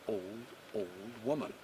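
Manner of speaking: usual speaking voice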